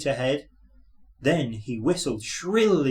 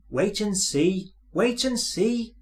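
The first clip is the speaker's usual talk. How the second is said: hissed; 7 semitones higher than usual. A narrator's reading. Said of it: "hissed Thomas".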